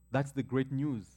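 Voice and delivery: ordinary delivery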